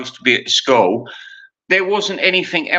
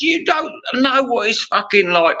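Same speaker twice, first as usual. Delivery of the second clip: retold exasperation; 9 semitones higher than usual. Retold row with daughter.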